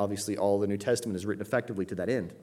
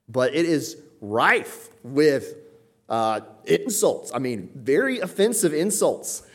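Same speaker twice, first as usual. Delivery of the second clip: ordinary delivery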